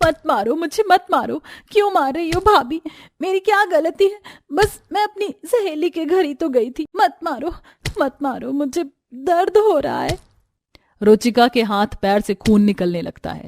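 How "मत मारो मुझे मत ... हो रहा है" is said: high, whimpering plea for mercy; acted victim's high-pitched pleading; pained pleading. A narrator's reading. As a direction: beg them to stop, in pain. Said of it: "दर्द से चिल्लाते चिल्लाते".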